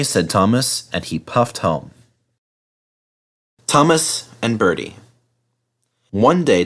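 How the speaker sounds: ordinary delivery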